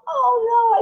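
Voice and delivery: high loud mock dismay; hammed-up oh no. Shown as a demonstration. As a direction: play the oops big. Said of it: "You can model it".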